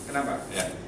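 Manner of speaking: ordinary talk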